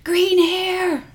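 shouted high across the street; yelling. Retold or imitated. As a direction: yell it out at a stranger. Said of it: "they'll just yell at me across the street".

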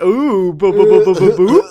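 loud, high cartoon stammer; mock flustered babbling. Host imitates a reverend hearing a flirty line, cartoonishly flustered. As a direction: burst into silly bouncing noises.

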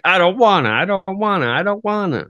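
put-on high singsong childish whine; whiny tantrum. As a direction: whine in protest, stretching each refusal. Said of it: "Sometimes he goes like".

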